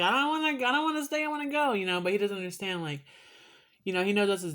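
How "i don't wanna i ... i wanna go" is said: high, loud whining protest; a pet refusing to stay, squealing high; whiny mad dog voice. Retold or imitated. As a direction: voice the sulking pooch, pitched way up. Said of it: "that's what he does when he gets mad".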